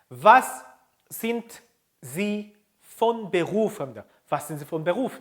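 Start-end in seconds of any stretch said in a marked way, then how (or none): 0.1-3.8 slow, a pause after each word; word-by-word model. Shown as a demonstration. Teacher introduces the job question, word by word. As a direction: separate every word clearly for repetition. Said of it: "바로 요렇게 합니다".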